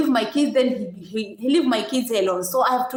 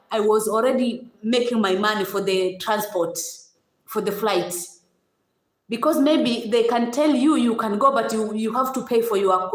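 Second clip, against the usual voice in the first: normal talking voice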